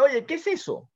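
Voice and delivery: sudden high, startled question; surprised wolf. Acted. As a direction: jump up in pitch as the wolf notices the collar. Wolf sees the dog's collar.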